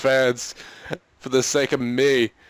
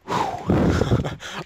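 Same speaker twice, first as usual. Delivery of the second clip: heavy sigh; 9 semitones higher than usual. Real, not acted. Losing a playoff game.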